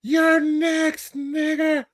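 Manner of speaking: high imitation of the screaming man; mock yell. Mocking the man from the clip again, high voice. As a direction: quote him in a high mocking voice.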